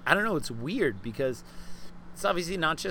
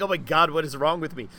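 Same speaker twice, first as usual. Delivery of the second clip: higher, louder mock alarm at oneself; retold nervous panic; 4 semitones higher, 7 dB louder than usual. Guest replays inner thoughts on meeting an idol, mock panic. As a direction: replay your inner freak-out, raised voice. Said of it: "I was uncharacteristically nervous".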